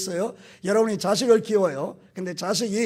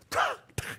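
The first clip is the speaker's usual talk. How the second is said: palmada imitada; 5 dB quieter than usual. Retold or imitated.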